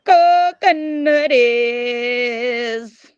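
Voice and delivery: long-held, sing-out nickname greeting; drawn-out greeting. Real, not acted. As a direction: stretch the name out very long, sliding down in pitch. Greets a chat member by nickname.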